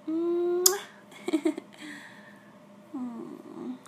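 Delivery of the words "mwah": voiced, louder, higher kiss sound; a big playful smooch; loud smacking kiss. Girlfriend sends her boyfriend a big loud kiss on Skype. Blowing kisses to distant partner.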